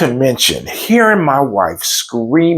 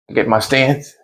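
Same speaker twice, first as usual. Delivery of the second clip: ordinary delivery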